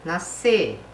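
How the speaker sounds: normal talking voice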